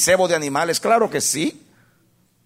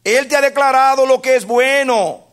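a high, loud voice of God calling to man; God's reply to man, lightly voiced and lifted; God answering; 5 semitones higher, 4 dB louder, slower than usual. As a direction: speak as God, a bit raised and earnest. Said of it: "Y dice Dios".

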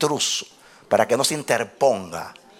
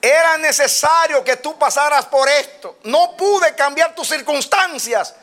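agudo, lento y enfático, a plena voz; prédica exaltada; 13 semitones higher, 4 dB louder, slower than usual. Real, not acted. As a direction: proclama con fervor la voz del Padre. (prédica encendida, en tono alto)

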